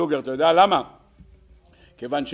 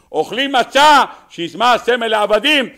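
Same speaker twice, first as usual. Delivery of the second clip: high, raised preacher's exclamation; loud exclaiming; 7 semitones higher, 8 dB louder than usual. Real, not acted. Rabbi showing listeners the night's contradictions, loud exclaiming. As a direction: call out the puzzle forcefully.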